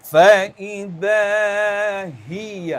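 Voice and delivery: فَإِذَا هِيَ recited with the ha sound pressed from the throat; pressed bass recitation. Shown as a demonstration.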